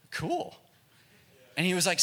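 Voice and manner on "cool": casual cool. Retold or imitated. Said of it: "And I was like".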